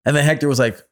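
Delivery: usual speaking voice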